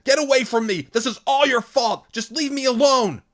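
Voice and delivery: very high, loud screaming; screamed. A narrator's reading. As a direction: scream at him through tears. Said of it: "she screamed".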